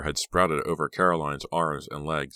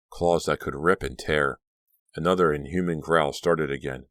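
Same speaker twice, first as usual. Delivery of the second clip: ordinary delivery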